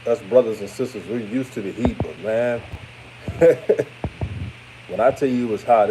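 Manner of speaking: ordinary talk, nothing marked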